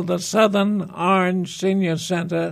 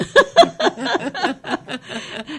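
a high, amused burst of laughter; hearty laugh; 12 semitones higher than usual. Real, not acted. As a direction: laugh out at the joke. Laughing at his own joke.

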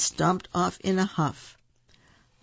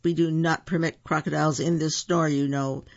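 ordinary delivery

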